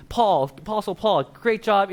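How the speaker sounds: a banquet host's toast; proud announcement. Retold or imitated. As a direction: announce each hero to the hall. Jesus honouring saints at banquet.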